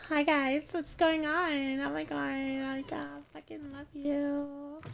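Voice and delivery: put-on high, slow gushing girly voice; hammed-up girl voice greeting chat. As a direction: gush like a sweet excited girl. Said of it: "use my real girl voice".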